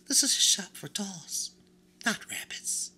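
a vain china doll sizing up a stranger; high-pitched doll voice. Acted. China doll asks the new rabbit what he is, high-pitched.

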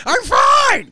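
very high, loud yell; mock furious shout. Bobby Blood Pressure told to calm down, mock enraged shout. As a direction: shout it like a man about to burst. Acts red-faced Bobby insisting he's fine.

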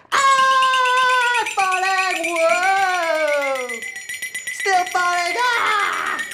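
high loud cartoon yell while falling; cartoon falling scream. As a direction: wail like a toon dropping down a hole. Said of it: "you might hear".

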